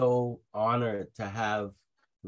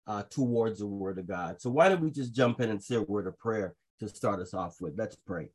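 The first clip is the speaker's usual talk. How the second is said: normal talking voice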